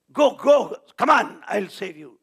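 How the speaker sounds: urgent loud, high cry; rescuing shout. Retold or imitated. Bhagavan calls to a child falling into fire, urgent shout. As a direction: shout them on to safety. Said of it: "shouted Bhagavan".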